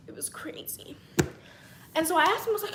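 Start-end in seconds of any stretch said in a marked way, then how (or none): 0.1-0.9 whispered aside, no voice. Real, not acted. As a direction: breathe it out as a secret. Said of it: "It was, it was crazy".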